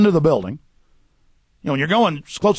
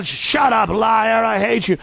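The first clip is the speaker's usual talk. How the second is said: higher, hammed-up angry shouting; mock angry yell; 4 semitones higher, slower than usual. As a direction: snap it back like a hostile heckler. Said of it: "folks that come up to me going".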